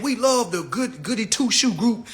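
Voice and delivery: ordinary delivery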